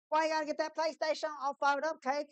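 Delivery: high, quick questioning voice; imitated viewer. Viewer asking about the PlayStation, high and quick. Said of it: "And you'll be like".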